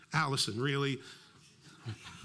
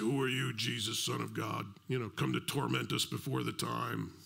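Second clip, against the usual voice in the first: demon voice; 5 semitones lower, 3 dB quieter than usual. Acted. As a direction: drop deep and dark into a possessed man's demon. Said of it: "That's my demon voice".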